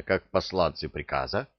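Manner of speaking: plain reading voice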